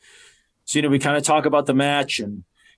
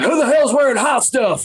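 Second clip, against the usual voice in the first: much higher and louder, an imitated angry veteran; gruff wrestler's challenge; 9 semitones higher, 4 dB louder than usual. Bob Holly sniffing the air in gorilla, annoyed, imitated loud. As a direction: bark it out like a annoyed locker-room bully. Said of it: "and he's like".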